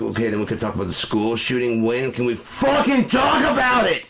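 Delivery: imitating an angry parent shouting; mock outraged shout. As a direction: build from a plea to a shout.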